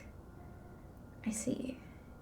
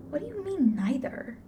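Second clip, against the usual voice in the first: sharp jump up in pitch on the question; surprised; 6 semitones higher than usual. Real, not acted. Player reacting to the character's life, startled disbelief. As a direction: caught off guard, can't believe it.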